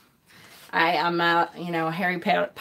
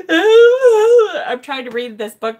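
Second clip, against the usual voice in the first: louder, higher, with a half-laughing sob in the voice; teary retelling; 9 semitones higher, 10 dB louder, slower than usual. Retold or imitated. Mother recalling reading a sad chapter to her son, laughing through mock tears. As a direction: tell it as if still choked up over the book.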